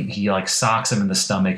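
ordinary delivery

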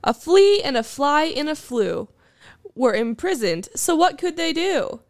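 playful sing-song recital; bouncy limerick lilt. Acted. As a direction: bounce through the rhymes. Recites tongue-twister limerick.

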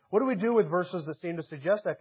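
normal talking voice

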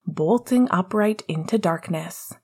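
usual speaking voice

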